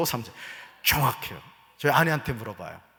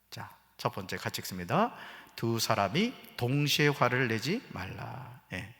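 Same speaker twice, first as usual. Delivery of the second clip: ordinary delivery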